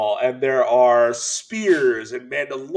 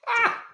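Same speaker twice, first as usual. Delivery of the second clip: very high, short squeal; imitated delighted cry. Dad reacting to a Wookiee pun, high squealed cry. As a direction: let out a high yelp at the joke.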